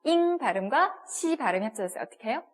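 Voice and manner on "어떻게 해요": ordinary talk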